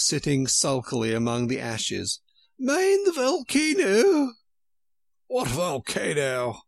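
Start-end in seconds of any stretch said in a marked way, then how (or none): 2.6-4.3 very high, loud, slow warning queen. A narrator's reading. 5.3-6.6 slow, lightly voiced worried king; anxious king. A narrator's reading. King looks anxiously into the fire, mildly worried. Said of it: "looking up anxiously into the fire".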